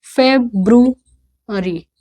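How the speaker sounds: फेब्रुअरी slowly in pieces, "feb... bru... ari"; practice pronunciation. Shown as a demonstration. Teacher drilling February with learners, practice pronunciation. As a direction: break फेब्रुअरी into slow syllables. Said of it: "इसको practice करिए".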